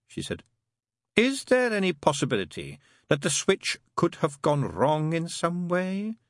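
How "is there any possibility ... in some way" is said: high, careful question rising slowly at the end; demon questioning. Acted. Crowley asking Mary about the switch, carefully. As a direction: probe patiently, stretching the last words.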